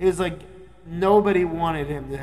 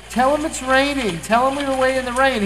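higher, louder imitation of a wife; urging wife; 8 semitones higher, 6 dB louder, faster than usual. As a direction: butt in urgently on a phone call. Wife urges husband during call.

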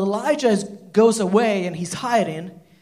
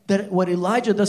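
ordinary delivery

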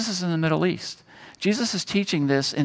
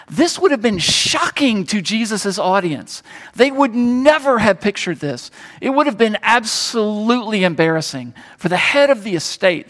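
louder and higher, pressing the point hard; full-force preaching; 7 semitones higher, 5 dB louder than usual. Acted. Pastor driving home the father's embarrassment to the congregation, preaching at full force. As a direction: preach it with rising conviction.

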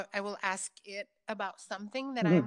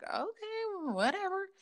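higher, airy brush-off; dismissive; 11 semitones higher than usual. Retold or imitated. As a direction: shrug it away. Mom dismissing warning.

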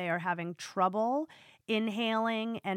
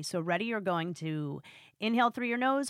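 usual speaking voice

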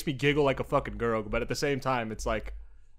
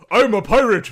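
booming pirate voice; 8 semitones higher, 10 dB louder than usual. Retold or imitated. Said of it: "God voice".

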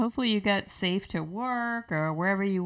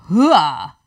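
loud army hoo-ah; loud gung-ho soldier; 11 dB louder than usual. Shown as a demonstration. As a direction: shout it from the chest. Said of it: "low, lower".

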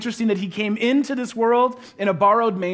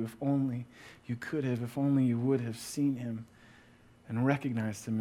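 hushed plea; 8 semitones lower, 9 dB quieter than usual. Real, not acted. Tender appeal to congregation.

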